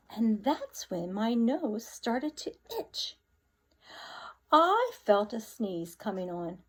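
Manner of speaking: lively read-aloud storyteller voice; story narration. Acted. Teacher reading the wolf's tale to the class, animated narration. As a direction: read it with big expressive swings.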